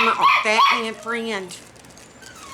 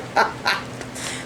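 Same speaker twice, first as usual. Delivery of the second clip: soft, voiceless laugh; real breathy chuckle; 6 dB quieter than usual. Real, not acted. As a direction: chuckle guiltily at your own joke. Laughs at her own bad joke.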